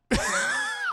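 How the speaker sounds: high, giggling laugh; burst of laughter. Real, not acted. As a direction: crack up at your own joke. Laughing at the retold line.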